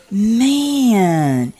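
awed exclamation, retold; amazed. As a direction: draw it out in amazement. Acted amazed reaction in story.

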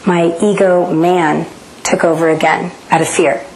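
normal talking voice

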